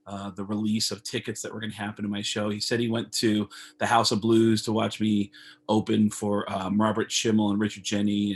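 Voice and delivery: ordinary talk, nothing marked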